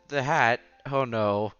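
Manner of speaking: ordinary delivery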